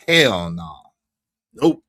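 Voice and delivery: ordinary delivery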